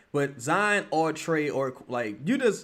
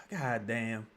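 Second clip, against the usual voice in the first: ordinary talk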